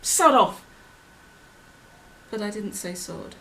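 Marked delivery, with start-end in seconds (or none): 0.0-0.6 loud, slow, emphatic snap; higher, forceful burst of temper; angry outburst. Acted. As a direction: shout it as a rude rebuff. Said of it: "I shock myself by saying".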